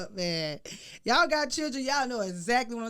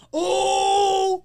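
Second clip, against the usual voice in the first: loud, high drawn-out shocked cry; 9 semitones higher, 10 dB louder than usual. Retold or imitated. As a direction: let out a big scandalized holler. Retells shocked reaction to daughter.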